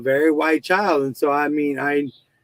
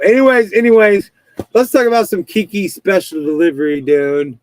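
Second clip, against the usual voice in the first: louder, higher call to move on; loud topic change; 3 semitones higher, 8 dB louder than usual. Real, not acted. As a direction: shout "anyways" to switch topics. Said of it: "changing topic loudly".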